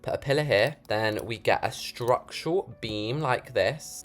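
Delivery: ordinary talk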